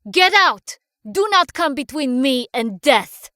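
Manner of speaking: loud, high furious shout. Acted. Cornered girl shouting at the intruding bullies, furious shout. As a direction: yell them out of the lab. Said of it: "and shouted".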